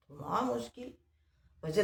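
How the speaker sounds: ordinary delivery, nothing marked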